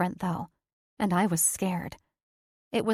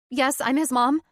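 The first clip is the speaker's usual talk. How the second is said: higher, louder, urgent answer; anxious mom, voice up; anxious mother; 5 semitones higher, 4 dB louder than usual. Acted.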